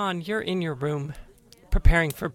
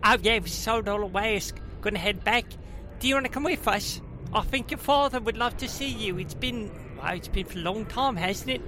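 pixie; 4 semitones higher than usual. Acted. Gnome cousin invites Winley.

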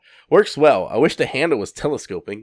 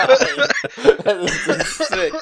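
words stretched out through slow laughter; can barely get it out for laughing; laughing hard; 8 semitones higher, 7 dB louder, much slower than usual. Real, not acted. Cracking up at squirrel joke.

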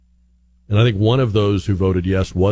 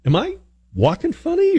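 self-conscious worry. Retold or imitated. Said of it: "I got to where I thought".

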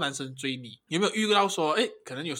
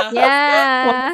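loud burst of laughter into yeah; cracking up, high and loud; real laughter; laughing hard; 10 semitones higher, 12 dB louder than usual. Real, not acted.

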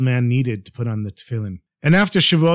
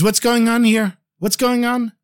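high, startled what's-going-on; high surprised call; alarmed demand; 9 semitones higher than usual. Retold or imitated. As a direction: burst in asking what the noise is. Baal Shem Tov hears commotion.

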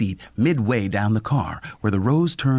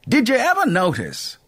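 lightly voiced old conductor, higher and breezy; a friendly old conductor shrugging it off; 11 semitones higher, 3 dB louder than usual. A narrator's reading. Conductor explaining his absence to the professor, higher casual voice. Mel muses aloud.

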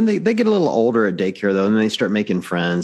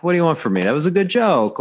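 higher-pitched, defensive protest; protesting dad; 9 semitones higher than usual. Retold or imitated. Dad defending his joke to the teacher, higher-pitched protest. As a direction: plead your case in mock indignation. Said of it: "I'm like".

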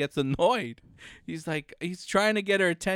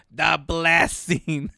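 slow explaining; 2 semitones lower, 4 dB louder, much slower than usual. Retold or imitated. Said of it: "He's like".

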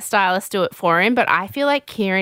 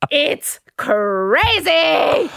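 high, very drawn-out words; stretched-out squeal of disbelief; giddy exclamation; 6 semitones higher, much slower than usual. Real, not acted. Host overwhelmed by the party chaos, giddy. Overwhelmed after laughing at retelling.

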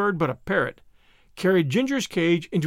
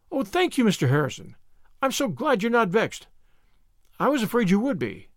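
high, gushing thanks; relieved gratitude; 5 semitones higher than usual. Acted. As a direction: let relief lift the voice up at the start. Anne relieved at being forgiven.

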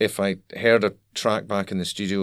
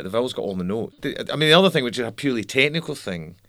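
ordinary talk

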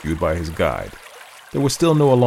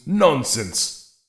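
cried out; 8 semitones higher than usual. Acted. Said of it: "cried the brigand".

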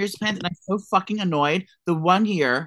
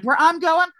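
pitched way up, bright and salesy; high influencer ad voice; 12 semitones higher, 5 dB louder, slower than usual. Shown as a demonstration. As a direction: announce it like a sponsored post. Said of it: "I'm gonna, like".

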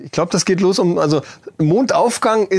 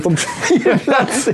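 laughing; 8 semitones higher, much slower than usual. Real, not acted. Laughing while repeating the joke.

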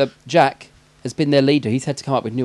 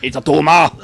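very loud Austrian action-star voice; Arnold Schwarzenegger; 2 semitones higher, 10 dB louder than usual. Acted. Co-host quotes film line, hammed-up action-star voice. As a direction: bellow it with a thick Austrian accent. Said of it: "that was more Arnold Schwarzenegger".

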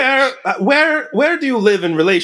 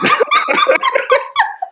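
high, helpless laughter; can't stop laughing at the botched name; long squealing laugh; laughing fit. Real, not acted. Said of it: "Please stop laughing".

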